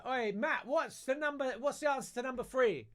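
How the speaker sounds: high-pitched mock call across a classroom; hammed-up shout without much volume; imitated shouting. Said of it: "someone else was shouting".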